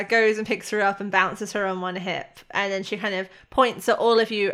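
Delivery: ordinary talk, nothing marked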